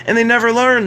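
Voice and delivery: they never learn, flung out high and loud; exasperated outburst; loud exasperated cry. Acted. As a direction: throw it up high in disbelief. Emphatic repeat, first take.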